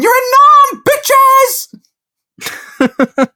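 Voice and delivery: high, loud gleeful shriek; hammed-up victory yell; mock triumphant yell. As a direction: shout it like a cocky soldier. Geezer yelling in the sixties.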